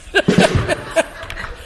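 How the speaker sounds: airy chuckle after the punchline; breathy laugh. Real, not acted. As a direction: laugh it off, unvoiced and light. Laughing at own joke.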